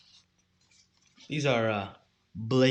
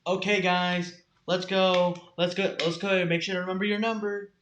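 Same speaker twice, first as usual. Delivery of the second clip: raised, louder group-wrangling call; Nathan; 5 semitones higher, 4 dB louder than usual. Retold or imitated. Imitating a leader wrangling freshmen, raised rallying call. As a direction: herd the group with a higher, projecting voice. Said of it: "my impression of Nathan is spot on".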